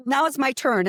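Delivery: ordinary talk, nothing marked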